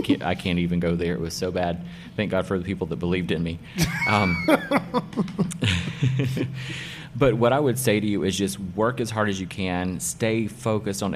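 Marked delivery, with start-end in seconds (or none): 4.5-7.1 high, rising laugh; burst of laughter. Real, not acted. Laughs at own bad haircuts.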